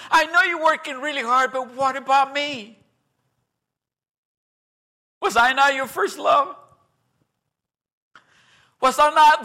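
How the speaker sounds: a hint of wounded God; pleading God. God to the busy believer, mildly hurt.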